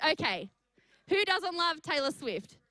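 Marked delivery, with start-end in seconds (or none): none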